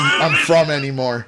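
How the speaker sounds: ordinary talk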